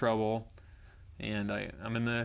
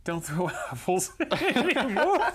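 high, slow mock tearful girl; put-on squeaky girl plea, laughing; imitated girl; 10 semitones higher, 5 dB louder, slower than usual. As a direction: draw it out as the hurt girl, breaking into laughter. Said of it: "and then she goes".

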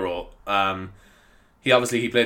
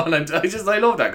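ordinary talk, nothing marked

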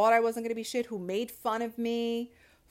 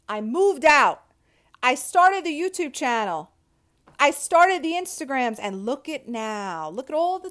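louder, higher, fired-up declarations; triumphant rant; 4 semitones higher, 9 dB louder than usual. Real, not acted. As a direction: shout the wins out with pride.

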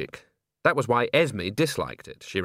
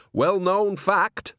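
high, very slow, sure of it; knowing old nanny; 9 semitones higher, 3 dB louder, much slower than usual. Acted. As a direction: stretch it out like gospel truth. Nanny declares with certainty.

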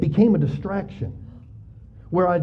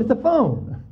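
higher, lightly voiced answer; mock deadpan reply; 6 semitones higher, slower than usual. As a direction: say it like it's obvious. Answers visitor in put-on voice.

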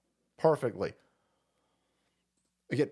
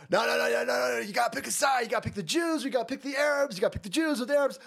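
raised, urgent mock shouting; insistent mock partisans; 6 semitones higher, much faster than usual. Streamer mocking those demanding a side, pushy mock shout. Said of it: "And then they go".

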